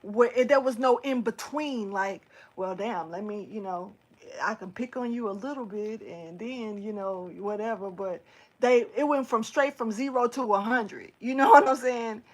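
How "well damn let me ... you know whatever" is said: lower, softer offhand aside; casual backing down. Retold or imitated. Voicing a bully easing off, low and offhand. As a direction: toss it off quietly.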